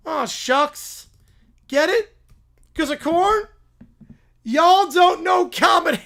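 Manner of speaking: hammed-up catchphrase shout. Applicant shouting his corn joke at the farmer. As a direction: yell the punchline at the farmer.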